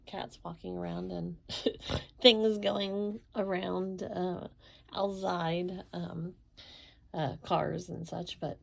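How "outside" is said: usual speaking voice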